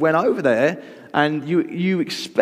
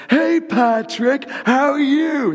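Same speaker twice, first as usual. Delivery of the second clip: drawn-out, high-pitched boisterous hello; mock over-jolly greeting, stretched way up high; big matey hello, words pulled long and high; hearty greeting; 14 semitones higher, much slower than usual. Acting out a boisterous castmate greeting.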